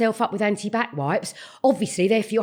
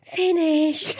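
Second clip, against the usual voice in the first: mock child voice; 6 semitones higher than usual. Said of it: "turn around and go".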